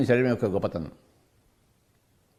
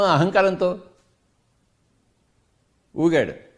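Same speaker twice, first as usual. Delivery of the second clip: preaching at full force; 4 semitones higher than usual. Acted. Teacher scolding a listener who cries over nothing, fervent preaching. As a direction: hammer home what they already have.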